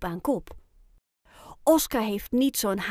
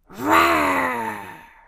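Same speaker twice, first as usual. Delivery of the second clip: loud drawn-out roar, falling away; 5 dB louder than usual. A narrator's reading. Ayoub copies Ruby before the race, roaring. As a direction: roar it out like a battle cry. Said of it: "brult Ayoub".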